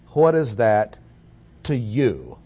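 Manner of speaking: what is that to YOU, leaning on you; stressed you. Shown as a demonstration. Preacher showing the emphatic "you" in Jesus' reply. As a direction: press the word "you" hard. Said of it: "the word "you" is emphatic".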